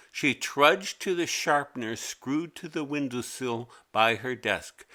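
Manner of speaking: ordinary delivery, nothing marked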